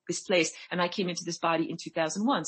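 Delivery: normal talking voice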